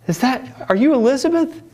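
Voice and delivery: high, loud, eager asking; mock-eager twin asking her name; eager question. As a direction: lean out the window and ask. Twins ask out window.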